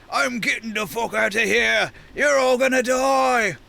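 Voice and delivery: terrified half-giant. Acted. Terrified half-giant fleeing the burning tower, panicked yell. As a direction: bellow in high-pitched cowardly panic. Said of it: "And the half-giant says".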